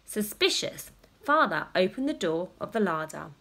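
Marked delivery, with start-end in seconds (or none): none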